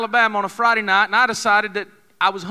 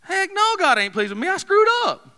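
high, exasperated inner voice; a sudden high cry of protest; fleshly side; 11 semitones higher than usual. Retold or imitated. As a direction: blurt it out in a high cracked voice. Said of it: "the fleshly side of me says".

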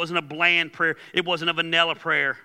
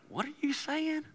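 baffled God; 10 semitones higher, 10 dB quieter than usual. Acted. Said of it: "God looks down sometimes and says".

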